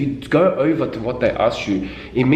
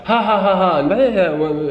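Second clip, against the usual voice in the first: mock giggly chatter; 7 semitones higher than usual. Mocking an unserious candidate with giggly chatter.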